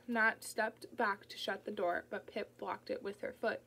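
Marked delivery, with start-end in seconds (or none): none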